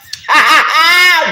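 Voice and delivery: high, loud burst of laughter; loud cackling laugh. Real, not acted. Reader delighted by the couple's banter, loud cackling laugh. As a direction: throw out a big high cackle.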